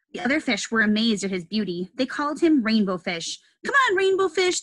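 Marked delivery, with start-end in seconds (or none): 3.7-4.6 high playful call to a friend. Acted. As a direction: call them over to play. Fish calling out in story.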